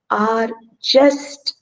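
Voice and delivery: ordinary delivery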